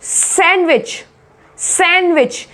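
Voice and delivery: सैंडविच as "sen-wich"; silent d demo. Shown as a demonstration. Teacher modelling sandwich, silent d. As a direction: skip the d in सैंडविच. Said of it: "बोलोगे तो वो गलत हो जाएगा".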